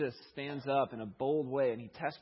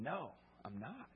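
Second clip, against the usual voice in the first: slow, soft no; hushed retold denial; quiet denial; 10 dB quieter, slower than usual. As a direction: deny it slowly and softly. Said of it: "He denied it".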